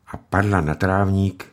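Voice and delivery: plain reading voice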